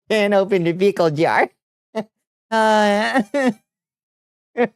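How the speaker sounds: high, laughing complaint. Real, not acted. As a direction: laugh through the admission. Laughing at failure.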